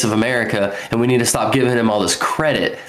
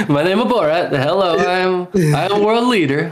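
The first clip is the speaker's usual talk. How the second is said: Borat impression; 7 semitones higher than usual. Mimicking Borat for the friends, Kazakh accent. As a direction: ham up a Borat accent introducing himself. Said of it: "Why'd I throw Borat?".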